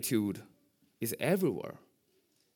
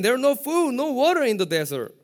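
mock complaint; 10 semitones higher, 7 dB louder than usual. Mocking Israelites' complaint.

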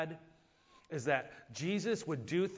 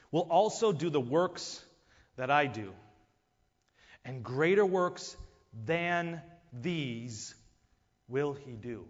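slow, weighty reading of the verse; solemn scripture reading; 4 dB louder, slower than usual. Shown as a demonstration. Preacher reading the text aloud to the congregation, slow and solemn. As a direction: let each phrase land with long pauses. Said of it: "I want to read it again".

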